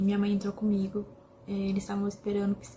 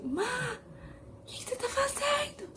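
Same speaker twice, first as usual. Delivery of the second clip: high, slow alarmed cry of a sister; shocked cry; 4 dB quieter, slower than usual. Retold or imitated. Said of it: "E começou a gritar". (grito assustado, agudo e lento)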